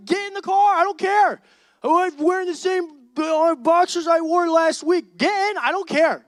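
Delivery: loud, high, repeated orders; exasperated shouting. Retold or imitated. Friend shouting at the late roommate to just get in. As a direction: bark get in over and over.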